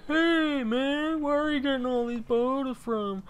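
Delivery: high-pitched mock whiner; mocking, high-voiced complaint; complaining viewer. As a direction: imitate a nagging viewer in a put-on high voice. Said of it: "if you guys are saying".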